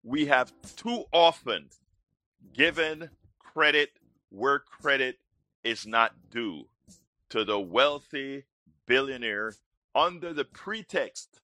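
high, rousing radio host delivery; show-opening announcer. Acted. Host greets Houston and the world, rousing announcer.

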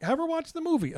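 high mocking voice, quoting commenters; mocking quote. Husband mocking commenters in a high voice. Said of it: "they're like".

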